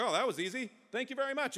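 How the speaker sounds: pleased buyer. Acted. Envoy thanking Napoleon for an easy sale, pleased and high. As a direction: play a delighted, easily satisfied customer. Relieved buyer in retold deal.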